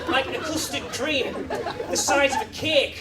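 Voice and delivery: normal talking voice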